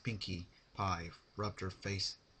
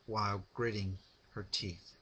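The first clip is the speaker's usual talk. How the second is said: usual speaking voice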